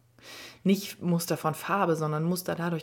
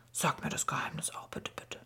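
whispered begging; 2 semitones lower, 7 dB quieter than usual. A narrator's reading. Papa begs for the secret.

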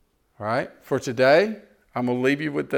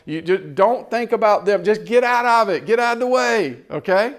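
much higher and louder urging; fired-up exhortation; 8 semitones higher, 5 dB louder than usual. Real, not acted. Preacher urging the congregation to get out of the way, excited shout. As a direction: push it up like a revival preacher.

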